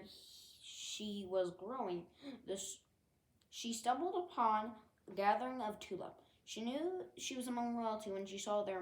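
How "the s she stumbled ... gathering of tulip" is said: usual speaking voice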